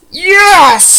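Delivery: high, loud victory shout; excited yes. Real, not acted. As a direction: cheer the win. Own Pokemon survives.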